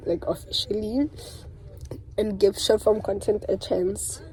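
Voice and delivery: usual speaking voice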